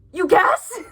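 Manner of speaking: high, loud disbelieving retort; outraged by the casual tone; incredulous. Real, not acted. Incredulous at the character.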